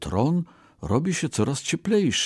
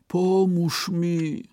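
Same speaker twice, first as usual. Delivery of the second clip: much higher, very slow plaintive plea; pitiful cry; 9 semitones higher, much slower than usual. A narrator's reading. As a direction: call out pitifully for help. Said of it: "zawołał żałośnie".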